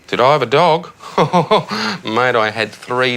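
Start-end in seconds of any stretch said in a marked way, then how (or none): 1.0-2.0 scoffing laugh. Real, not acted. Dad scoffs at dog question.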